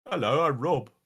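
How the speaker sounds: a hint of a British accent, introducing himself; lightly imitated British accent. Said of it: "Rob has a British accent".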